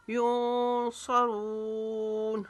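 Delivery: युनसरून very slow with nasal ikhfa, रून long; slow recited युनसरून; tajweed recitation. Shown as a demonstration. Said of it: "रून को लंबा खींचेंगे".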